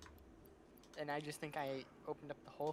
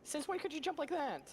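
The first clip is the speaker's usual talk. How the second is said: high, loud startled yelp; shocked outburst; 13 semitones higher, 6 dB louder than usual. Real, not acted. Mob jumps at him.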